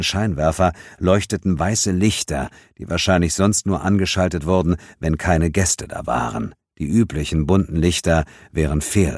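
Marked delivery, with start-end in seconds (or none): none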